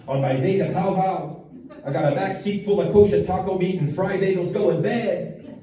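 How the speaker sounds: put-on high, excited Yiddish-accented rant.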